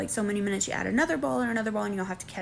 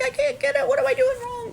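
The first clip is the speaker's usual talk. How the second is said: high loud mock wail; imitating panicked crying; 6 dB louder than usual. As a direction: mimic her panicked cry. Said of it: "crying again".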